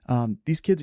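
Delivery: ordinary talk, nothing marked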